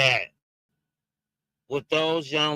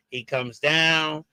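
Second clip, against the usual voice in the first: ordinary delivery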